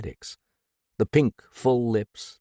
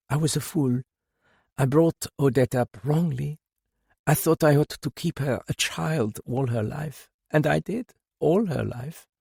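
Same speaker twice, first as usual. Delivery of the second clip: higher grieving mother; 6 semitones higher than usual. A narrator's reading. Madame confesses wrong upbringing.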